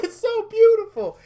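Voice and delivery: high-pitched, weepy gushing; hammed-up tearful awe; mock crying. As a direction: squeal it as if moved to tears. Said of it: "they start crying".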